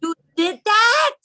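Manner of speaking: high-pitched, slow scandalized question; scandalized squeal; shocked. Real, not acted. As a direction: stretch it out in laughing disbelief. Shocked reaction to friend's confession.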